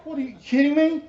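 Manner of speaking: high, loud disbelief; mock outrage at being called to preach; incredulous. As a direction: throw it out like it's absurd. Incredulous outburst.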